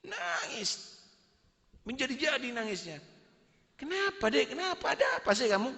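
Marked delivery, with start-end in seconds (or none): 3.8-5.7 worried husband. Acted. Husband asks his crying wife.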